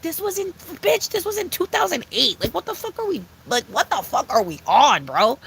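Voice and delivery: high, disbelieving rant; can't believe people care; incredulous rant. Real, not acted. Ranting about 2008 video.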